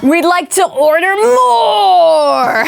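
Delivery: high, slow laughing YouTuber voice; hyped vlogger order through laughter. Pretend. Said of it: "YouTuber voice doing daily activity things".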